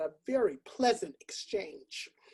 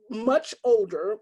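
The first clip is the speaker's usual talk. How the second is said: normal talking voice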